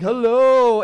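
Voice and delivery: loud booming giant greeting; booming giant hello. Retold or imitated. Teacher voices the giant access point, loud and booming. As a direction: boom the hello. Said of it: "this guy's like".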